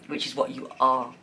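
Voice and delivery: normal talking voice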